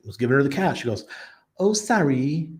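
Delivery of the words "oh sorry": high, put-on British lady's accent. Church lady to cash-paying visitor, mock British accent. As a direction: imitate her polite British accent, pitched up. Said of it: "British accent".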